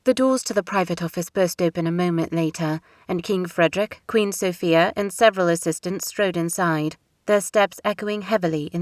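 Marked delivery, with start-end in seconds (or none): none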